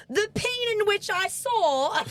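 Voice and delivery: high, slow imitation of a flustered innocent; mock-prim high voice, slightly hammed-up; innocent sitcom heroine. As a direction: draw it out high, dodging the word. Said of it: "she just goes through that whole thing".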